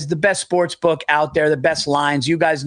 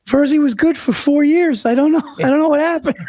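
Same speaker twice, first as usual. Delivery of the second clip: higher, mock mournful imitation of Bill; imitating Bill's lament; 7 semitones higher than usual. Mocking Bill to his podcast partner, high mock lament. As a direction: ham up a sad, dazed friend. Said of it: "he leans forward like this and he goes".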